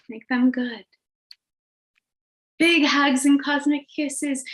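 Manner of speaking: gentle, sing-song meditation guide; guided meditation. Acted. Leads group out of meditation.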